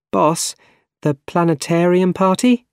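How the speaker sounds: high voice rising into a question; tentative reminder. A narrator's reading. Secretary reminding her boss of the party, tentative. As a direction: gently prompt the boss, pitched high.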